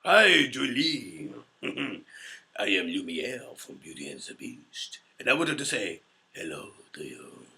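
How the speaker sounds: a French charmer bowing as he greets; Lumiere. Acted. Fan asked Lumiere to greet Julie, slow French-accented charm. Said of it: "in a Lumiere voice".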